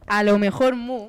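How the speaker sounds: boosted volume, drawn-out words; making sure it's heard. Real, not acted. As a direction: say it loud and slowly into the mic. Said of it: "Se escucha, se escucha".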